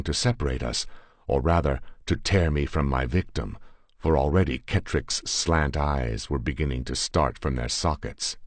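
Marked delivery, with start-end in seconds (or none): none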